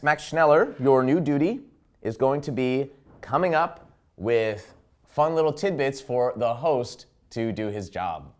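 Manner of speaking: ordinary delivery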